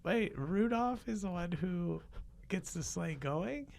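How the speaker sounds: put-on high, curious kid voice; a wide-eyed kid needling Santa; child's voice. Child up late on Christmas Eve questioning Santa, high kid voice.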